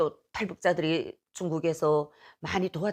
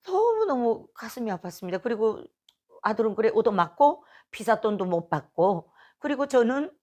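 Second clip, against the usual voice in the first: ordinary talk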